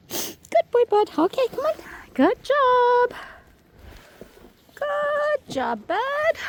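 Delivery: very high, bright praise voice for the dogs. Real, not acted. Dog sitter praising the dog, very high sing-song. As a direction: sing it up high to reward the pup.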